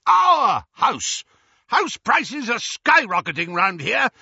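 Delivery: loud, high furious rant; ranting uncle. A narrator's reading. Vernon ranting about plot.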